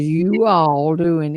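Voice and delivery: slow, drawn-out "you all", two distinct words; separated "you all". Shown as a demonstration. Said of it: "'Cause nobody says".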